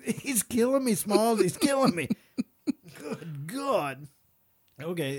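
ordinary talk

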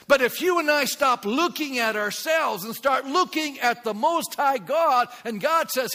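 loud, high preacher's exhortation; preaching at full force. Real, not acted. Pastor exhorting his congregation, loud and high. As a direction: press the point with fervor.

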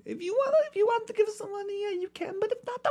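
very high squeaky voice; high falsetto voice. Acted. High mock voice asking donations.

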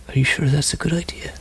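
quick, hushed question as a dwarf woman; lightly voiced dwarf woman, under the breath; doubtful dwarf woman. Dwarf woman questioning her companion's decision to talk, hushed and doubtful. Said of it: "you hear the dwarf woman go".

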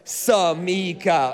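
Samika slow and steady, stress on MEE; said slowly with the crowd. Shown as a demonstration. Pastor leading the church in saying Samika together, slow. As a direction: lead the room through Samika slowly. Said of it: "Ready? Go".

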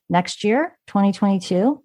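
rising at the end of "year" and "twenty-two", as if asking; questioning intonation. Real, not acted. Podcast host unsure of new labeling facts, questioning intonation. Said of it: "I say that as if it's a question".

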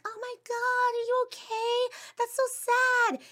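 very high-pitched gushing sympathy; mock pity. People pitying a teen about her parents, mock concern. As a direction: imitate over-concerned people in a high squeal.

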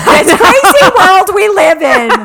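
high, loud, laughing mom voice. Acted. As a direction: belt it like an exasperated mom. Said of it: "I just died in my mom voice".

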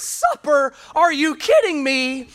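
a lightly hammed-up wife, disbelieving; incredulous wife. Wife exasperated at husband in joke.

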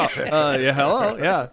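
teasing impression of an eager yes; mock duh answer. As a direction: scoff it as if the answer couldn't be clearer. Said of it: "You go".

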